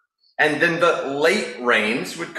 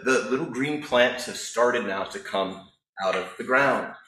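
ordinary delivery

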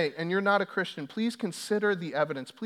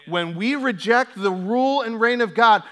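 "When we reject the rule and reign of God" shouted higher and louder; loud preaching repeat; 7 semitones higher, 11 dB louder than usual. Shown as a demonstration. Pastor repeating the takeaway to the church, loud preaching. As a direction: repeat it now at full preaching force. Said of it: "so take this away, please".

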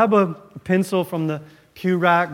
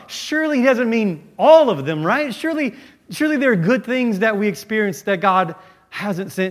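ordinary talk

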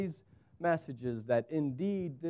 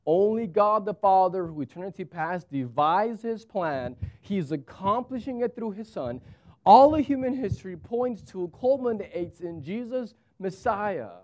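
louder, higher, rising to a preacher's cry; full-force preaching; 4 semitones higher, 4 dB louder than usual. Acted. Pastor proclaims God's plan to the congregation, full-force preaching.

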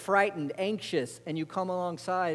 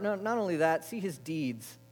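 normal talking voice

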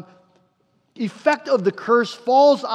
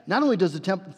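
usual speaking voice

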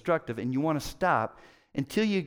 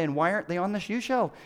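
ordinary delivery

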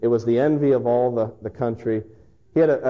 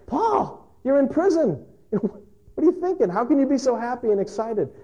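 much higher, disbelieving questions; incredulous; 12 semitones higher than usual. Retold or imitated. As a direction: ask in amazed disbelief. Onlookers questioning Paul.

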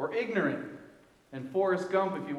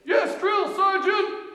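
slow, much higher and louder shouted reply; barked military recruit reply; 9 dB louder, slower than usual. Retold or imitated. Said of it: "By the drill sergeant in the army".

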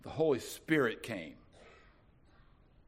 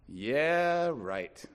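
sarcastic yeah right. Pretend. Scared disciple answering Jesus, sarcastic scoff. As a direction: scoff at the idea. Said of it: "You might have been tempted to say".

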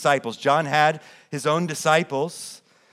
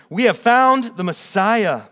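much higher and louder, thrilled news to his brother; excited announcement; 9 semitones higher, 9 dB louder than usual. Acted. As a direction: burst out with the good news. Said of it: "told him".

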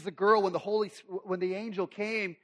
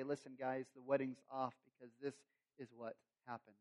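dejected; 6 semitones lower, 13 dB quieter than usual. Acted. Joey telling friends wedding off.

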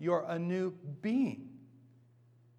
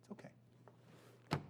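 soft reassurance; 13 dB quieter, much slower than usual. Shown as a demonstration. Urging tearful pleading, demonstrated.

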